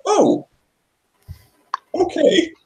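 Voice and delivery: high surprised oh okay; shocked player reacting to the heart ripped out; startled. Real, not acted. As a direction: caught off guard by the monster, pitch shooting up.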